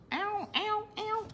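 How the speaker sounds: high, sharp yelps of pain. Real, not acted. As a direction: yelp at the hot glue burn. Burned by the hot glue.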